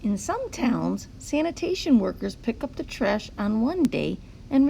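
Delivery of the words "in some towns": plain reading voice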